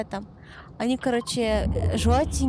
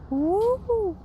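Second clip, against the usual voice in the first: imitated whistle; 10 semitones higher than usual. Shown as a demonstration. Imitating fireworks.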